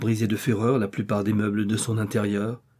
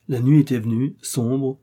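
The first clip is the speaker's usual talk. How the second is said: ordinary delivery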